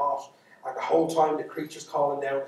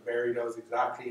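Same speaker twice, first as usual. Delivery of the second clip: usual speaking voice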